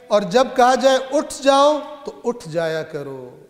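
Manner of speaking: high, loud scripture declamation; preaching recitation. Acted. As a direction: proclaim the command to the whole congregation. Recites scripture translation in sermon.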